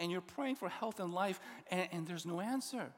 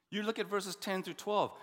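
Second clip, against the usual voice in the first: usual speaking voice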